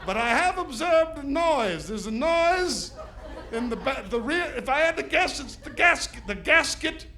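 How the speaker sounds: put-on overly formal posh-sounding drunk; slow, careful fake grown-up voice; sober voice. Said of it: "I had the best sober voice".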